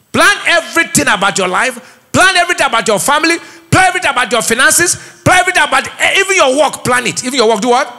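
high, driving exhortation; emphatic preaching. Real, not acted. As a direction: hammer each item home to the congregation.

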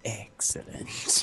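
slow, dry whispered excellent; deadpan it under your breath; sarcastic whisper. Real, not acted. Sarcastic after disaster.

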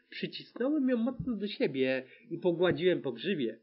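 ordinary delivery, nothing marked

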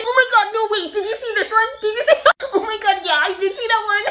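high, loud laughing squeals; giddy teen. Acted. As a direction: gush to your friend through giggles. Teens excited over a phone video.

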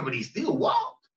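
rising shout on walked; preaching emphasis. Real, not acted. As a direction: drive home that he walked.

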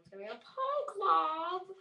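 high, loud hammed-up exclamation; mock excited yell. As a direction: belt it out in an exaggerated voice. Mock exclamation about the Pokemon.

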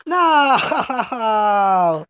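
long drawn-out falling "no"; wailed no. Real, not acted. Player losing the round, long wail of dismay. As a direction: wail it out as you lose.

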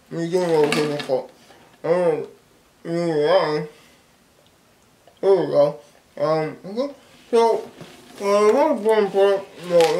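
talking around many gumballs; mouth full of gum. Real, not acted. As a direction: cheeks stuffed with candy. Seen 0: Cheeks bulging, lips pursed, fingers pushing gumballs into mouth; candy tube held.

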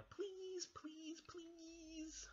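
very high, squeaky pleading; begging. Acted. Baby Bear begs for a pirate chair, squeaky pleading. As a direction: draw out the final plea in a tiny voice. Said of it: "begged Baby Bear".

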